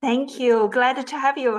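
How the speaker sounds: usual speaking voice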